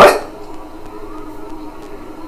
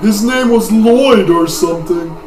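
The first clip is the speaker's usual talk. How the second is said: put-on old man voice; 7 semitones higher, 6 dB louder than usual. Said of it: "This is not the voice".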